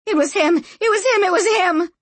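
high, loud frantic repeats; shrieking. A narrator's reading. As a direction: shriek it over and over. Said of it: "shrieked Sanchia".

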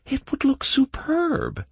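put-on high, slow dreamy girl voice. A narrator's reading. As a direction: savor it, starry-eyed. Kaelin, wistful, girl voice.

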